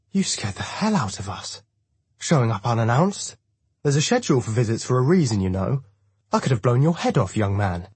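put-on high, swooping old-lady scolding; scolding old woman. A narrator's reading. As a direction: tell off the unannounced visitors. Old woman scolds unexpected visitors.

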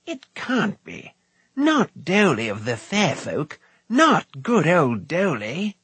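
slow, high-pitched dismay; stunned bard refusing the news; astonished disbelief. A narrator's reading. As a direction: protest it slowly, unable to believe it. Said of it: "echoed the astonished bard".

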